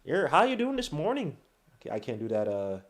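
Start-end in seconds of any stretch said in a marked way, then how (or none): 0.0-1.4 put-on higher, swooping Fargo accent; amateur Fargo Midwest impression. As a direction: try a sing-song Midwest greeting. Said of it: "that Midwest accent".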